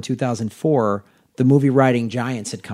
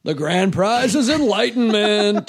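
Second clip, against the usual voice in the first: higher, slow game show host voice; booming prize announcer; 7 semitones higher, slower than usual. Pretend. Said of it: "this is my Buddhist game show".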